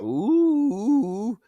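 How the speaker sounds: hammed-up high gasp then ooh; mock gasp. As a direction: fake shock, gasp high. Mock shock at chat joke.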